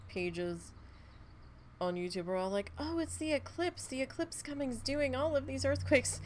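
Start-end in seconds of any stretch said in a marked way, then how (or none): 2.8-6.2 mocking panicky chatters. Mocking panicky chat users.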